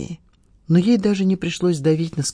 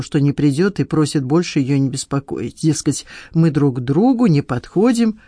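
ordinary delivery, nothing marked

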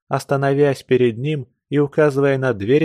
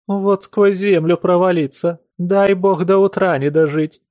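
pleading peasant woman; 7 semitones higher, 3 dB louder than usual. A narrator's reading. Servant swearing innocence.

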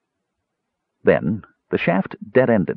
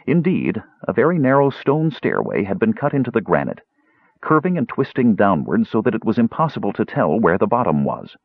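ordinary delivery

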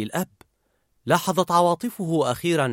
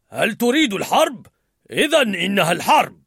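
loud, higher war cry; enraged Anger; 6 semitones higher, 5 dB louder than usual. A narrator's reading. Riley's Anger declaring war, enraged. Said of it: "ثائرا".

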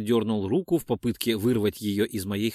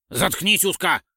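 higher, irritated order to shut up; angry retort; 6 semitones higher, 3 dB louder than usual. A narrator's reading. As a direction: cut the other off angrily. Snapping back in the quarrel. (злой окрик)

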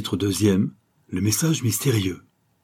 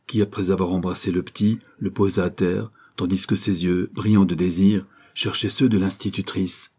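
plain reading voice

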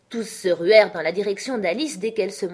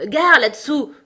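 loud, higher-pitched warning call; warning shout; 7 semitones higher, 8 dB louder than usual. Acted. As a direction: yell a warning to those below. Warning shout as slate falls. (cri d'alerte)

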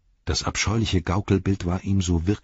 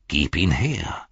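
demanding cry; 3 semitones lower, slower than usual. A narrator's reading. Frodo to Sam, wanting the Ring back, restrained cry. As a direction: stretch each word into a possessive demand. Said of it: "schrie er".

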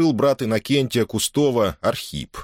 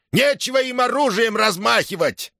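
high, indignant cry; straining shout; 13 semitones higher than usual. A narrator's reading. Sergei objects to soldiers waving weapons, shouting. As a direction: keep shouting, pitched high. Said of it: "надсажался криком Сергей Ильич".

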